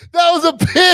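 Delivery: high, loud rising protest; loud disbelief. Real, not acted. Host objecting to co-host's wrong animal, high loud protest. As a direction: shout it in disbelief.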